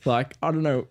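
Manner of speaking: ordinary talk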